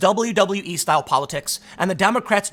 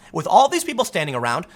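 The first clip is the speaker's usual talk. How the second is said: ordinary talk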